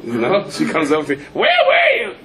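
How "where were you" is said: shrill demanding wife. Retold or imitated. Wife demands where he was.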